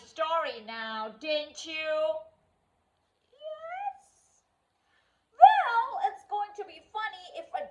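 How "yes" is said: meek yes. Acted. Meek reluctant answer.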